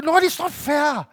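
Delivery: high, loud, drawn-out protest; protesting complaint to God. Retold or imitated. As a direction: plead indignantly that it's unfair. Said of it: "I was thinking".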